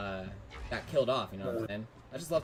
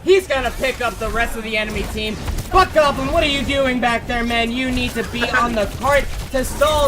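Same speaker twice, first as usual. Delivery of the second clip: louder, higher hyped esports call; live play-by-play; 6 semitones higher, 9 dB louder than usual. Acted. Caster calling the fight to viewers, loud and hyped. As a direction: ride the teamfight, pushing the energy up.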